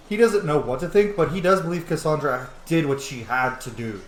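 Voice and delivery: usual speaking voice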